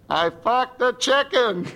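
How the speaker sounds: punchline hollered from the door; mock yell back. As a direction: call it back over the shoulder, drawn out. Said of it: "he yells back the one thing".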